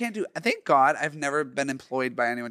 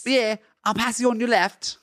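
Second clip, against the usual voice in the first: higher-pitched amateur Cockney; Cockney accent; 7 semitones higher, 4 dB louder than usual. Pretend. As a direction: play the scene in put-on Cockney. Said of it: "the accent is Cockney".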